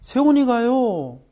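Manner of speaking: hesitant teacher. Retold or imitated. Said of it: "하면서 말 안 하는 거예요".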